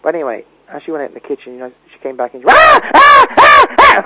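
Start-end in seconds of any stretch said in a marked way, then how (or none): 2.5-4.0 screaming. Retold or imitated. Retold aunt screaming at spider.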